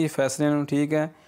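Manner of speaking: ordinary talk, nothing marked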